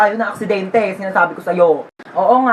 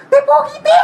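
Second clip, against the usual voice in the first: giddy squeal. Acted. Spotting a handsome guy.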